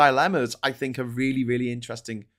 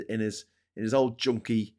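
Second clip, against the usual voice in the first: ordinary talk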